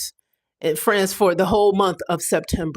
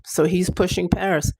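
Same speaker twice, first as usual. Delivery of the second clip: normal talking voice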